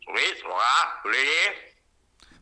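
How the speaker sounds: gibberish said as if reversed; mock reversed phrase with rising ends; backwards speech. Shown as a demonstration. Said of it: "Decime otra".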